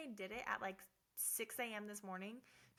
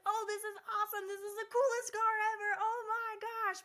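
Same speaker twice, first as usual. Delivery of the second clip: much higher and louder gushing; excited; 10 semitones higher, 7 dB louder than usual. Pretend. Pretending to be a new car owner, thrilled gushing. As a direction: rave like you just got the keys.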